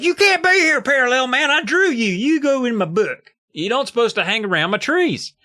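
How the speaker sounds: high-pitched hammed-up panic; mock freak-out. Comic creator confronting his own drawn character, mock freak-out. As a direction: protest in a high, overwrought voice. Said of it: "Eddie freaks out at him".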